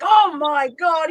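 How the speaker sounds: loud, drawn-out mock revulsion; retold disgusted exclamation. Storyteller imitating his reaction to smelling stink bugs, mock disgust.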